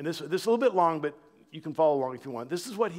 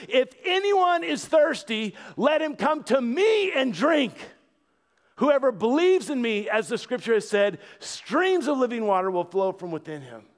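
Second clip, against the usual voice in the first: loud proclamation; 9 semitones higher, 7 dB louder than usual. Acted. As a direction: call out to the crowded temple courts. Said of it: "with a loud voice".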